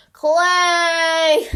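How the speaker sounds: high, loud, stretched-out summons; exasperated yell. Acted. America summons Henry Clay, exasperated yell. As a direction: holler the name with weary exasperation. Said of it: "does a big eye roll and yells".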